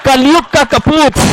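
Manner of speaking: mock father cursing his son; mock exasperated outburst. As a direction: play the wronged father, bellow it slowly.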